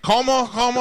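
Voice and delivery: high, loud imitation of the driver asking Como, como. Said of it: "my accents are bad".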